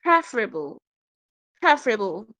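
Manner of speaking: "preferable" as PREF-ruh-bul, each take set apart; correct pronunciation of preferable. Shown as a demonstration. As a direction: model "preferable" for learners, stress on PREF. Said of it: "It is what?".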